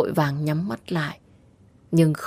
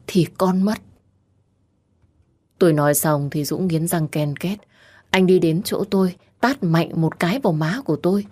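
ordinary delivery, nothing marked

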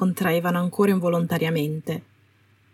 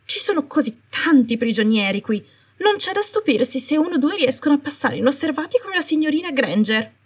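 higher, faster, flustered excuse; agitated Umbridge; 8 semitones higher than usual. Acted. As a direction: rush the excuse up in pitch, rattled. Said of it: "La voce soave della Umbridge cominciò ad agitarsi".

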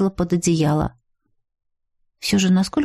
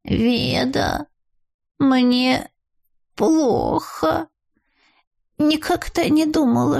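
high, slow, moaning dying voice; 5 semitones higher, slower than usual. A narrator's reading. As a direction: groan it out like you're at death's door. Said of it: "умирающим голосом простонала".

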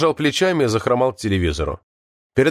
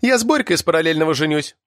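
higher-pitched, quick announcement; быстрая реплика; 6 semitones higher than usual. A narrator's reading. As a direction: say it promptly, in a raised pitch. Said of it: "быстро сказала Галина". (быстро, повыше голосом)

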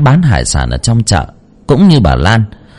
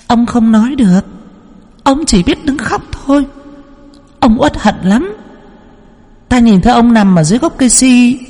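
put-on much higher, ringing child's voice; child spirit voice; 13 semitones higher than usual. Possessed medium telling Lan about her dead brother. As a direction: speak as a knowing spirit child. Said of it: "Cậu Bảo tiếp tục".

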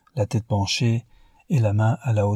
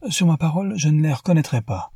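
higher-pitched, amused exclamation; laughing exclamation; 7 semitones higher, faster than usual. A narrator's reading. Newman jokes to the marquis about the guests, exclaiming in amusement. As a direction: cry it out with a hint of laughter. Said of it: "s'écria Newman en riant".

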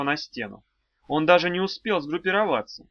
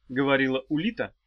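ordinary delivery, nothing marked

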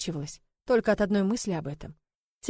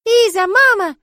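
put-on high, loud little-girl wail; sobbing child; 12 semitones higher, 11 dB louder, slower than usual. Sonya clinging to Iza as mama, wailing childishly. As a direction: cry out as a heartbroken small child. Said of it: "зарыдала Сонечка, вцепившись в меня".

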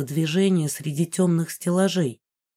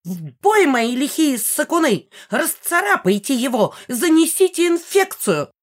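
high, squealing battle cry; rally the cats in a squeal; squealing old woman; 8 semitones higher than usual. Acted. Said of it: "послышался визгливый голос".

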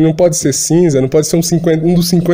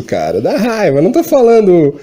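ordinary talk

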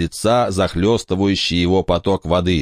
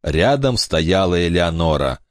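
plain reading voice

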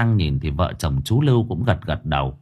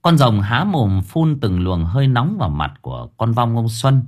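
ordinary delivery

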